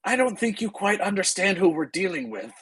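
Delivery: high-pitched, uneasy warning; Ismark's unease. Acted. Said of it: "try and steady himself".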